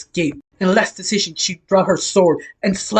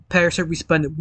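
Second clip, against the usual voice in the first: ordinary delivery, nothing marked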